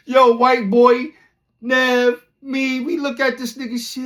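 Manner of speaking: high, loud, slow exclaim; cracking up in disbelief; excited disbelief. Real, not acted. Recalling the friend's yell with Nev, excited.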